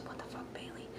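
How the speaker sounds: barely audible, unvoiced aside; whispered aside. Real, not acted. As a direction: whisper it away under your breath. Muttered aside about the text.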